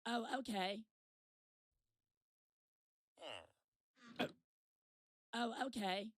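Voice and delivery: lightly voiced woman answering; mock woman through the stall door; woman's voice. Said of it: "And I hear".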